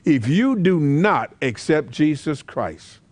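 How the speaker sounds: usual speaking voice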